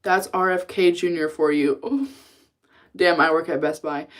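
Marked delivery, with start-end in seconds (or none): none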